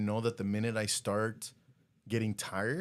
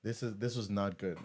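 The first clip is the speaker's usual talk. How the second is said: normal talking voice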